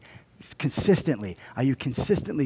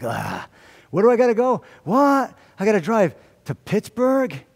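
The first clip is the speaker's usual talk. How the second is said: whining about driving; 6 semitones higher than usual. Retold or imitated. Pastor mocking his own dread of driving, high whiny complaint. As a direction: groan at the thought of a drive. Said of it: "It's like".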